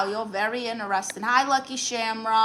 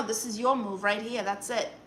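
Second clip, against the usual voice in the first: normal talking voice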